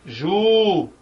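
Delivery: "ju" drawn out long; a single lengthened "ju"; prolonged stressed syllable. Shown as a demonstration. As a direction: stretch the vowel of "ju" to show the stress. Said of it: "quem é a sílaba tônica".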